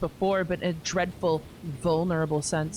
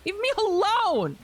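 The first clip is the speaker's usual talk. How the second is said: much higher, slow, loud cry to be let go; desperate shout; 3 dB louder, slower than usual. Acted. Hedwig trying to break free of Eustace, desperate shout. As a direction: pull away and yell it in panic.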